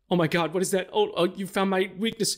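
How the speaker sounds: high, hammed-up fake terror; a mock panicked crook, pitched high; mock scared villain. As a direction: pretend to be terrified of a tiny weapon. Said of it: "he's like".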